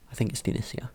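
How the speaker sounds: ordinary delivery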